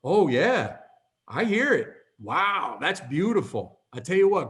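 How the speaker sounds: acted amazement to humor grandma; high, swooping agreement; playing along. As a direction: go along with her wonder. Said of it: "this time I played along with it".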